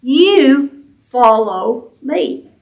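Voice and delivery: "You" stressed, words drawn out very slowly; slow, weighty "You follow me" with "You" leading; emphatic. Shown as a demonstration. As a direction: land on "You", then let the rest fall. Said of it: "The thou word there is emphatic".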